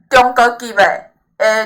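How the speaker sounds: ordinary delivery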